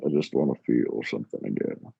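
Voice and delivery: low, world-weary Western cowboy; jaded cowboy. Pretend. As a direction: play a tired old gunslinger by a campfire. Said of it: "You sound like a jaded cowboy".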